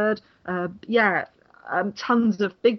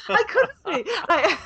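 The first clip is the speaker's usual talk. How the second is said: bashful laughing denial; 11 semitones higher, 4 dB louder, slower than usual. Retold or imitated. Junior rider's bashful denial, laughing.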